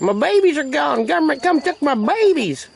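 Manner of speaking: a hammed-up high chicken wail; voicing the broody hen. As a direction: whine it like a hen robbed of her chicks. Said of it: "She's all like".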